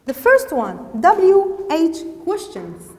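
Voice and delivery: loud, emphatic lesson heading; announcing a heading. Shown as a demonstration. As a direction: announce it firmly to the class.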